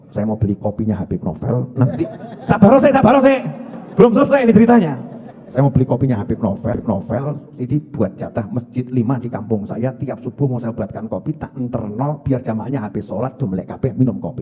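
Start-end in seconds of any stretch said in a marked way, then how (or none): 5.4-14.4 low, softened voice planning a good deed; quiet inner resolve. Acted. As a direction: think it through aloud, low and subdued. Resumes the man's quiet inner vow. (niat dalam hati, pelan dan rendah)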